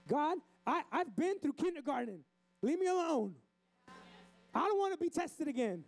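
high, mock-whiny plea to God; protesting like the kid. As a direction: complain to God like an overwhelmed child. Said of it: "I feel like we're like that".

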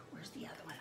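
soft whispered muttering to self. Real, not acted. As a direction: search under your breath.

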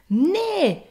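high, loud cry of no; shouted refusal. A narrator's reading. Finn to the butcher, shouted refusal. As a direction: shout it defiantly, pitched high. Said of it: "riep hij".

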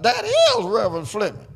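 startled husband's eager call; excited husband. Retold or imitated. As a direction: blurt it out high as the eager husband. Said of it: "the husband got up".

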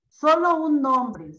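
solo stressed and slowed to answer. Shown as a demonstration. Answering a student's question with stress on solo. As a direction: lean on the word solo. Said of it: "¿o solo un nombre y un apellido?".

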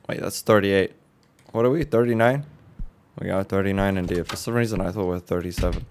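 usual speaking voice

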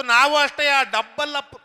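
loud outburst topping the insult; mock angry retort. Other brawler answering the boast, mock angry retort. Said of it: "ಅವನಿಗೂ ಸಿಟ್ಟತ್ ಬಿಡ್ತು".

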